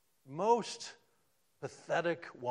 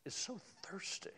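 a hushed whisper of longing; 4 semitones higher, 11 dB quieter than usual. Acted. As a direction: whisper it, breathless and intimate. Preacher names her need.

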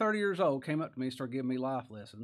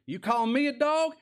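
high, loud mock outrage; acted affronted disbelief; indignant retort; 11 semitones higher, 7 dB louder than usual. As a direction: fire it back as an offended question. Imagined offended retort to Christ.

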